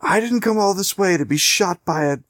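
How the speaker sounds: high, exasperated villain voice; a high-pitched, huffy tyrant complaining over the radio; Pagan impression. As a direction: play the annoyed villain on the radio. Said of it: "you hear Pagan on your radio, and he's like".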